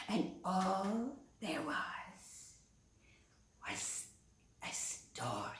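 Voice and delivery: whispered, slow and hushed. Acted. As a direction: drop to a whisper for mystery. Storyteller hushes for effect.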